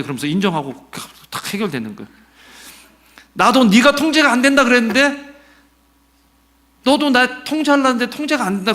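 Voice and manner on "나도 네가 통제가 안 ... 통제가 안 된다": much higher and louder, a hammed-up exasperated mother; mother voiced high. Mother in the car conceding to the father, high and loud. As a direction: squeal it out like the mother finally giving in. Parents' argument retold, voiced.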